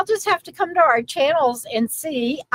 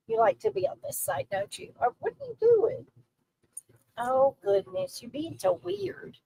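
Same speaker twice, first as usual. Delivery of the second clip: soft pet talk; 6 semitones higher, 8 dB quieter than usual. Real, not acted. Teasing her dog in her arms, hushed and fond. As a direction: murmur fondly at the pet.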